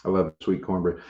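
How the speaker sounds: normal talking voice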